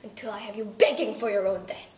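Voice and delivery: mock-menacing Russian accent. Russian accent, threatening the interviewer with death. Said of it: "with a Russian accent".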